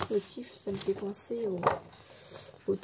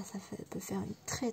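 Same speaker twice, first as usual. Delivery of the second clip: ordinary talk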